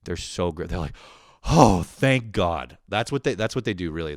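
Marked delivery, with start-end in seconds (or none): 1.5-2.8 slow, louder sighed relief; relieved sigh. Retold or imitated. Customer reconnected to tech support: slow relieved sigh. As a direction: breathe it out in relief. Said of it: "they sigh like that".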